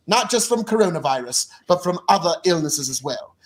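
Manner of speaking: British accent impression of a prime minister's address; measured, amateur British accent, speechifying; Boris Johnson. Host imitating the prime minister's national address, British accent impression. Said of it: "the best Cockney accent of Boris Johnson".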